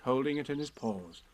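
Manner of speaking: usual speaking voice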